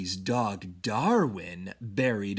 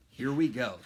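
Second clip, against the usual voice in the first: plain reading voice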